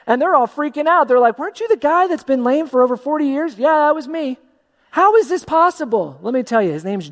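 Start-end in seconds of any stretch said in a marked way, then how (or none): 1.4-6.2 higher, amazed questions; astonished crowd. Acted. Crowd questioning the healed lame man, amazed and higher. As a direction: gasp it out like a stunned bystander.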